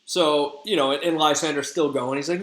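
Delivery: plain reading voice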